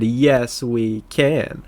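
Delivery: normal talking voice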